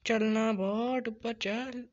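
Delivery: loud, drawn-out urging; high, slow cry of exasperation; exasperated urging. Real, not acted. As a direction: stretch the words in frustration. Urging character upward in game.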